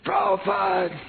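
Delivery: high calling yell; a disciple shouting after his departing guru. Retold or imitated. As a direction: call his name across the yard to stop him. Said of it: "I yelled out".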